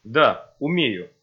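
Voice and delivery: ordinary delivery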